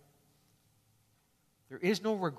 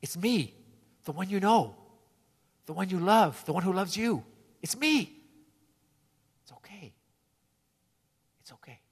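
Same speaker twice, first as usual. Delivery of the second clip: tender Jesus; 4 semitones higher than usual. Retold or imitated. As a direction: comfort a frightened friend gently. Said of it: "Jesus comes to us and says".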